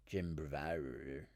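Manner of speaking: normal talking voice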